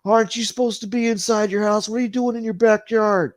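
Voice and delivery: high, loud mock call-out; nosy cyclist. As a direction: shout across the yard at him. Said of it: "Some guy riding his bicycle says".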